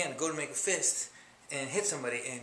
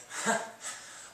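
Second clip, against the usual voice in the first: breathless laugh. Real, not acted. Laugh after punching.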